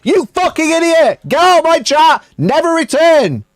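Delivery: loud, high-pitched outburst; exasperated rant. Real, not acted. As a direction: tear into an idiotic chatter at high pitch. Ranting at a chatter, kicking them.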